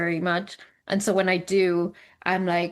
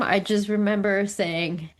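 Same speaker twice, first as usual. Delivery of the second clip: normal talking voice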